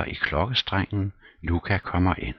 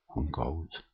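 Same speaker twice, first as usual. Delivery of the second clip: ordinary delivery, nothing marked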